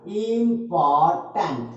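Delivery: Important slowly, as इं-पॉर-टेंट; syllable by syllable. Shown as a demonstration. Teacher shows how it splits, important in slow syllables. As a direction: separate each beat of important. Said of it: "अगर इसको तोड़ें".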